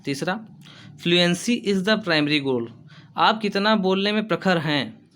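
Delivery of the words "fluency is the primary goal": slow, careful dictation pace; teacher reading a point for students to copy. Shown as a demonstration. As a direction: spell it out slowly for notes.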